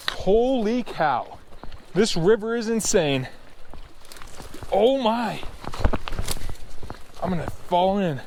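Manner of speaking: 흥분해서 비틀거리며 말하기; losing balance. Real, not acted. I'm gonna fall in.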